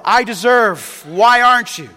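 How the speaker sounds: loud, clipped mock complaint; mock the world's grumble; entitled demand. Preacher voicing the world's complaint to God.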